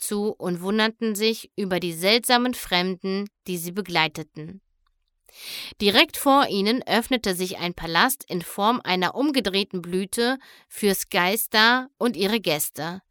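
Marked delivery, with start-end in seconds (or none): none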